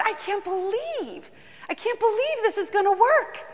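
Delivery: high, breathless disbelief; amazed. Retold or imitated. Pastor to God, high, in amazed disbelief.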